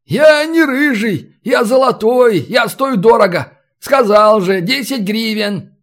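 high, emphatic bargaining retort; indignant haggler. Acted. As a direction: protest loftily and stick to your price.

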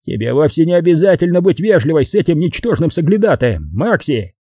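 a touch of bluster; thunderous. A narrator's reading. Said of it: "К Хатчинсу наконец-то вернулся громоподобный голос".

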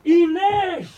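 calling her name from afar. Acted. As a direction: call after her across the square. Calling after her in street. (laut hinterherrufen)

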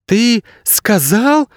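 slow, very high incredulous exclamation; astonished cry. A narrator's reading. Girl hearing a dog speak, astonished cry. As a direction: cry it out high, disbelieving your ears. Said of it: "крикнула она, забыв о всякой осторожности".

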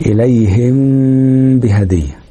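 slow recitation, م of إليهم held in a long nasal hum before بهدية; ikhfa of meem. Shown as a demonstration. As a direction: draw out the ghunna on the final م of إليهم, then release into بهدية. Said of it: "وقلنا إزاي بنعمل إخفاء الميم".

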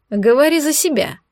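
mocking quip; smirking. A narrator's reading. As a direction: tease with a snort. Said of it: "хмыкнул Шантар".

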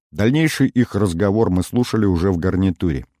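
ordinary talk, nothing marked

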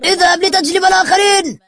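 high, urgent yell; shouted order. A narrator's reading. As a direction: shout the command far above normal pitch. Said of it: "فصرخ زعيمهم إلى أحدهم".

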